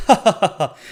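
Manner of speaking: mock cackle at someone's misfortune; mocking laugh. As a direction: laugh at him like a cruel crowd. Crowd mocking dead man.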